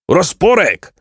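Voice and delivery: high, ringing roll-call name. A narrator's reading. Bandit chief calls the next man, shouting. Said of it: "wołał Herszt".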